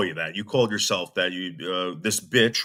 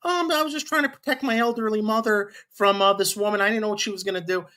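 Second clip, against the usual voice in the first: much higher, innocent-sounding mock voice; mocking Ruby; 11 semitones higher than usual. Reactor voicing what Ruby should have said, high and mock-innocent. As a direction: put on a high, falsely innocent excuse, rushing the end. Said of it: "You could have said the first time, Ruby".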